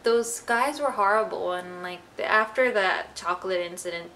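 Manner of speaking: ordinary talk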